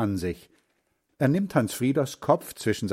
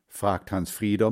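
ordinary delivery

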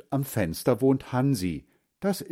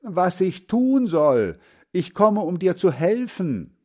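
calling out; 8 semitones higher, 5 dB louder than usual. Acted. Boy loudly explaining to his deaf grandfather. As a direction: spell it out loudly. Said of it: "ruft Hans Frieder".

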